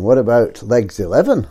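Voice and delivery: ordinary delivery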